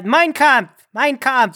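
rival attempt at a German dictator impression; hammed-up German catchphrase; Hitler. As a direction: show off a mock German accent. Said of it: "I could do better".

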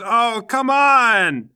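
high, loud, drawn-out protest; exasperated cry. Acted. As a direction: wail after a ride leaving without you. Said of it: "and he goes".

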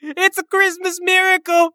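overjoyed sob-shout; joyful cry. Pretend. Player greeting his revived friend with a high tearful joyful cry.

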